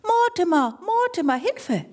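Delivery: high, panicked calls, rising on the second name; a small trapped animal calling out; frantic cry for help. Acted. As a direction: shout the name desperately, then beg for help.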